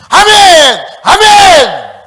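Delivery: high, sustained outburst from the pews; sudden all-out shouted cry; startlingly loud amen. Retold or imitated. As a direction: imitate a worshipper suddenly shouting at full force. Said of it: "막 큰 소리".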